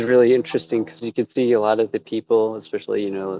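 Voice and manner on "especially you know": usual speaking voice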